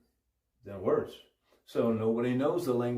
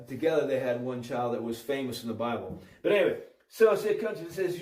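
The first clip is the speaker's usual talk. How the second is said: normal talking voice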